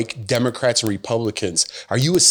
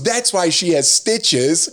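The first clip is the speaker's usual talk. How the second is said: little son; 6 semitones higher, slower than usual. Retold or imitated. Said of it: "She goes".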